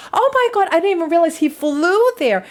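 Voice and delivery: high, loud shocked squeal. Real, not acted. Vlogger reacting to news the fan flew: high shocked squeal. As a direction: squeal it out in disbelief.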